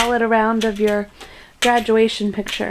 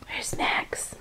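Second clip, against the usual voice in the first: whisper; 15 dB quieter than usual. Real, not acted. Whispering to the cat.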